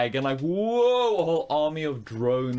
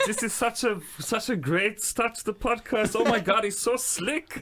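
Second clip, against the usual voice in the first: higher, gushing fan voice; mock gushing; 8 semitones higher than usual. Host imitating himself, higher gushing praise. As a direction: gush like an impressed fan. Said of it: "I was just like".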